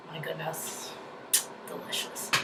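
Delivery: breathy whisper of pleasure; hushed delight. Real, not acted. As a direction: whisper it like tasting it. Savoring remembered pizza.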